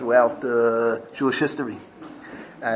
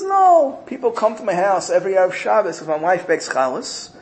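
much higher, louder meek little voice; humble disciple brushing off praise in a high voice; humble Naftali; 9 semitones higher, 3 dB louder than usual. Retold or imitated. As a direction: shrink yourself, high and self-effacing. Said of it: "He says".

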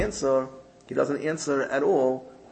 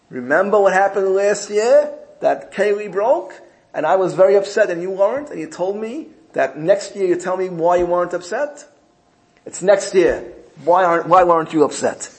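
high, much louder wife voice; demanding wife; 6 semitones higher, 7 dB louder than usual. Retold or imitated. Wife demands her answer a year later, high and insistent. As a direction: press him for the answer, high and loud.

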